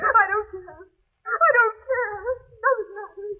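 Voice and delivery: slow, sobbing repetition; breaking down in tears; sobbing near hysteria; crying. Acted.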